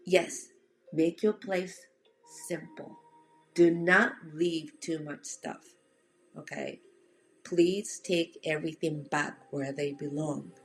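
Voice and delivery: ordinary delivery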